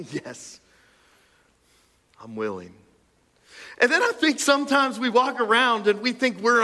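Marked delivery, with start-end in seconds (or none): 0.1-2.7 quiet gentle reply. A narrator's reading. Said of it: "You're willing to touch the untouchable".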